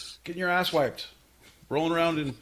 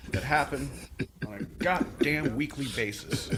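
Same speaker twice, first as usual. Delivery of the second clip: usual speaking voice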